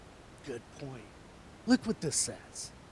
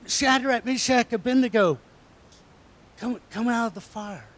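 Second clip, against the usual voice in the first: calling out; 5 semitones higher, 5 dB louder than usual. Acted. King calls men from furnace.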